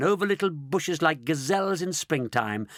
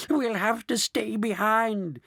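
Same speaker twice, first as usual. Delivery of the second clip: very slow, high mock wail; 6 semitones higher, slower than usual. A narrator's reading. Grandma Georgina giving up on the trip, slow high wail. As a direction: drag it out in mild despair. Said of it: "wailed Grandma Georgina".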